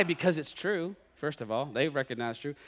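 ordinary delivery

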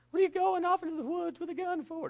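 high, shrill-pitched mom freaking out; panicked mom. Retold or imitated. Mom panicking at dad leaving with a gun, high voice. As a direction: play the alarmed mother, way up high. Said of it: "uh, freaking out".

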